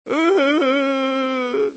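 loud high anguished cry out. Retold or imitated. Preacher reenacting his cry to God. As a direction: cry out in broken pain.

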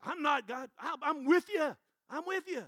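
high, loud eager pledge; eager disciples. Retold or imitated. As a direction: pledge loyalty, all fired up. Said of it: "all the disciples said the same thing".

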